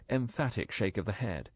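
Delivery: ordinary delivery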